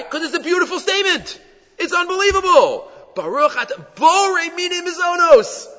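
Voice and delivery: high, exclaiming with amazement; impassioned wonder. Real, not acted. Teacher proclaiming a blessing a beautiful statement, impassioned wonder. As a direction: marvel aloud, pitched right up high.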